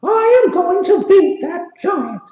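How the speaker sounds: a tiny hero declaring he's had enough; squeaky cartoon mouse full of resolve; Mickey's voice. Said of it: "said Mickey".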